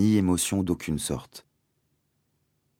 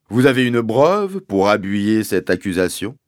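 higher, loud, pompous sergeant; police sergeant swapping P and B; 4 semitones higher, 7 dB louder than usual. A narrator's reading. Sergeant questioning the landowner's accusation, pompous, P and B swapped. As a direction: pronounce every P as B, with official ponderousness. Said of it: "remplacer les P par des B".

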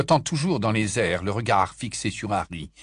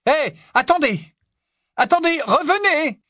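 high, loud shout down the corridor; calling out; 7 dB louder than usual. A narrator's reading. Harry shouting after the fleeing ghost, loud. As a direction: call after her to stop.

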